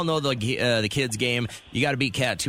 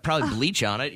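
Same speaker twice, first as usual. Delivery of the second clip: ordinary talk, nothing marked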